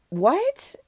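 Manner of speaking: high squeak of disbelief; sudden high-pitched disbelief; shocked what. Retold or imitated. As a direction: jump way up in pitch, stunned. Shocked reaction retold.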